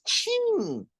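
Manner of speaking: a sudden high yelp of dismay; dismayed exclamation. Pretend. Believer reading a hard verse, high dismayed yelp. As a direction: blurt it out like you just got caught.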